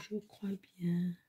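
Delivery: under-the-breath repeat; hushed self-reassurance; whisper to herself. Real, not acted. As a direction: whisper it as a quiet afterthought. Muttering to herself while checking.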